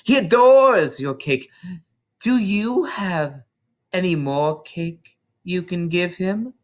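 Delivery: high, mocking sweetness, slowing down; Trunchbull. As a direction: fake kindness with menace underneath. Trunchbull voiced in a story.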